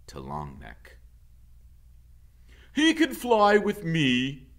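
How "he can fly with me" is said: booming goose leader announcement; commanding goose leader. Acted. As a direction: address the whole flock like a proud leader.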